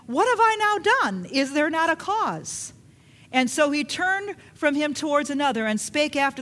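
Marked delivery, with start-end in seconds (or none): 0.1-2.7 much higher, pleading protest; indignant young David. Retold or imitated. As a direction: protest your innocence, voice up high. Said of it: "And then David said".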